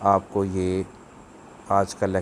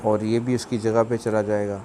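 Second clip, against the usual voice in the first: usual speaking voice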